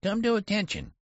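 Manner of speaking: high treble attempt at a commanding shout; attempted thunder. A narrator's reading. Boy general to the sentry, failed thundering in treble. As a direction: try to thunder, but come out high-pitched. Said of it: "he tried to thunder".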